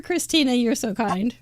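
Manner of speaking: ordinary talk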